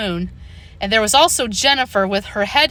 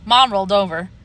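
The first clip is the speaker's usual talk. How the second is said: ordinary delivery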